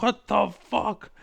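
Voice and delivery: loud, high, drawn-out exasperation; mock outraged disbelief. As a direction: replay your shock, stretching each word loud and high. Acts reaction to being banned.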